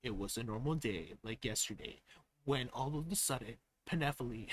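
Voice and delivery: hushed narrator impression; imitating the narrator. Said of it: "get all the loudness out of my voice".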